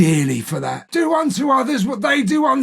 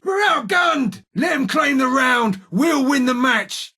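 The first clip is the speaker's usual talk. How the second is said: high, urgent battle shout; shouting to teammates; 6 semitones higher than usual. Acted. Gunfighter rallying an outgunned team, shouting high. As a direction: yell it across the fight.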